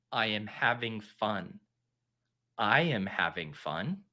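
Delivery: slow, low, soft self-convincing repetition; slow mantra. Pretend. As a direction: recite it slowly and quietly, trying to believe it. Forces self to repeat mantra.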